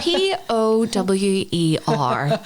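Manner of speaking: each letter of "P O W E R" spoken slowly and separately; spelled out slowly. Shown as a demonstration. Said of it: "that's the subtitles for the podcast".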